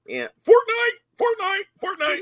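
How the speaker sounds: very high, loud mock kid voice; whiny chant, hammed-up as an insistent kid; imitating his son. Dad mimicking his Fortnite-obsessed son, high mock kid voice. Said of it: "but he was like".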